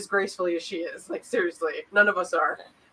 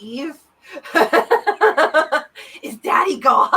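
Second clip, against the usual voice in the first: put-on higher, louder, giggling kid glee; laughing mock glee; 8 semitones higher, 7 dB louder than usual. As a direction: squeal like kids whose dad just left. Mock kids, dad left.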